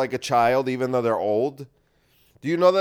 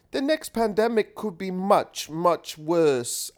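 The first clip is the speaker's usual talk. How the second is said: put-on higher, faintly British accent; Greta, a little British; 5 semitones higher, slower than usual. Host mocks Greta's warning in a hint of British accent. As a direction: quote her in a light British accent. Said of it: "that sounded a little British".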